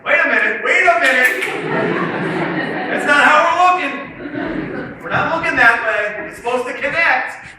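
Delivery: loud, high-pitched mock panic; freaking out. Speaker voicing the students' confused brains, freaking out. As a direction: protest in hammed-up alarm. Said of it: "your brain right now is freaking out".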